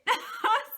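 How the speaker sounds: bright burst of high laughter. Real, not acted. Teacher laughing at her own advice, high and loud laugh. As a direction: laugh out at the joke.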